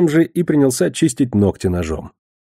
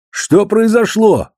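high, loud, drawn-out demand; impatient outburst; 11 semitones higher, 5 dB louder, much slower than usual. A narrator's reading. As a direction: burst out, stretching the words slowly. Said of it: "не выдержал ростовщик". (нетерпеливо, высоко и громко, врастяжку)

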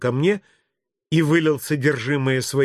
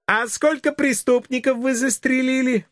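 put-on high-pitched kid voice; inquisitive child; 11 semitones higher than usual. Child asking the policeman about shootings, high kid voice. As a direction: pipe up like a nosy preschooler.

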